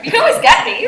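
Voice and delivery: playful insist. Real, not acted. Joking about being reachable.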